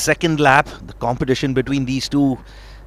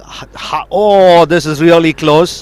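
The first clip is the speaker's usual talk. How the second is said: excited; 4 dB louder than usual. Real, not acted. Excited commentator, tight battle.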